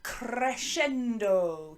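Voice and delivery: "Crescendo" drawn out slowly, kre-SHEN-do; slow Italian-style "Crescendo" with a long middle; demonstrating pronunciation. Shown as a demonstration. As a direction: relish every syllable of "Crescendo". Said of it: "Can you say that?".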